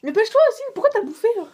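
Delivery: high, loud urging; excited. Real, not acted. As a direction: push her on excitedly. Urging friend, raised voice.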